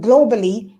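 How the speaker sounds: ordinary delivery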